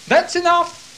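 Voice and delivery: explode, cutting him off; outraged outburst. Acted. High priest jumping up at Jesus, loud and high. Judge jumps up.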